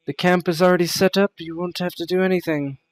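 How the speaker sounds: brisk dismissal. Acted. Fatima brushing off the guests.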